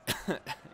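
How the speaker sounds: short breathy cough; quick unvoiced cough. Real, not acted. Sick commentator coughing again.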